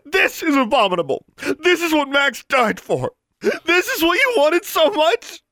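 very high, loud, out-of-control scream; hysterical shriek. A narrator's reading. Daughter brandishing the locket at her father, shrieking. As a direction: shriek each accusation at full pitch. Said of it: "Her voice had become a shriek".

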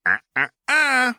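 high singsong tut-tut; mocking singsong uh uh uh; teasing warning. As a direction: wag a finger with your voice. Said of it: "Jinx said".

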